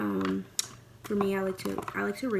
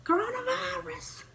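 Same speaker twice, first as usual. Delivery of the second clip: high hype shout; 3 dB louder than usual. Acted. Hyping herself up, shouting.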